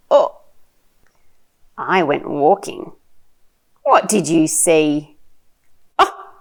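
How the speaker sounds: animated, slow picture-book reading with big swoops; reading to a toddler. Shown as a demonstration. Mum sharing a picture book with her little one, animated child-directed reading. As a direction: draw out the words, playful and full of wonder.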